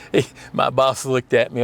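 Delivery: ordinary talk, nothing marked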